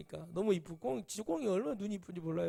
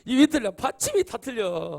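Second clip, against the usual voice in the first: shocked exclaim; 5 semitones higher, 11 dB louder than usual. Real, not acted. Preacher shocked at friend's misspelled love letter, loud outburst. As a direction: burst out at the misspellings.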